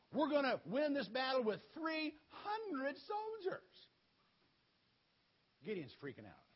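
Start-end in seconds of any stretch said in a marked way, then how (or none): 0.1-3.7 slow, higher-pitched announcement; God's proclamation. Acted. God announces the battle plan to Gideon, slow proclamation. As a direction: draw out the number with rising pitch.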